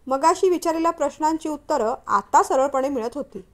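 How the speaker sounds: plain reading voice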